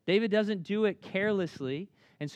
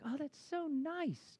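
doting dad; 11 semitones higher, 8 dB quieter, slower than usual. Retold or imitated. Dad sees boys sharing chair.

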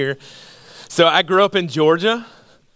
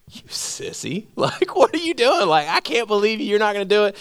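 mocking taunt; 5 semitones higher than usual. Cousin taunting narrator.

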